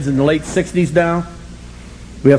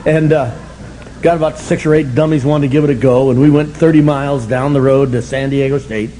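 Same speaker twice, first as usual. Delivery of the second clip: usual speaking voice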